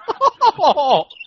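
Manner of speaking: high, bursting laugh; real high-pitched laughing fit; laughter. Real, not acted. Cracks up at the joke.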